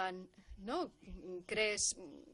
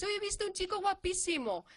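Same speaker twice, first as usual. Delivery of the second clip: much higher, gushing imitation of a girl; mock feminine voice; 10 semitones higher than usual. Imitating the women who raised a boy, high and gushing. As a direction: gush like an excited girl, pitched way up. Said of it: "mueven así las manos".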